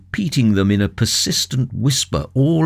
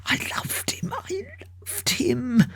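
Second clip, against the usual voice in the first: breaking whisper; 9 dB quieter than usual. Acted. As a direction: voice collapsing into a broken whisper.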